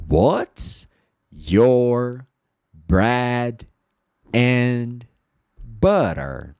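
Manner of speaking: "What's your bread and butter?" slowly, one word at a time with pauses. Shown as a demonstration. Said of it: "Speak aloud". (palavra por palavra, devagar)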